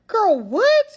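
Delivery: high, loud mock disbelief; hammed-up "come again?" incredulity; dumbfounded. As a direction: blurt it out, acting utterly baffled. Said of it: "just dumbfounded".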